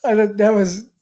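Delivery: can't hold back a laugh telling it. Real, not acted. Laughing as she tells her friend the punchline. Laughing while recalling the story.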